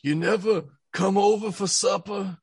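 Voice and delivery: a hint of a godfather scolding; mock Godfather scolding an old friend who never calls; Don Corleone. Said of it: "I turn into, uh, Don Corleone".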